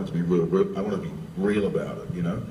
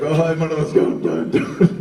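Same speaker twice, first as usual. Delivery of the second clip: excited greeting; 9 semitones higher, 7 dB louder than usual. Retold or imitated. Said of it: "he was like".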